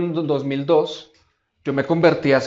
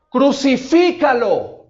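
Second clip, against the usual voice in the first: higher, louder, slow shout; crowd shouting; 8 semitones higher, 7 dB louder, much slower than usual. Retold or imitated. The crowd screams at Pilate, a preacher's loud shout. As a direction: shout it like the mob.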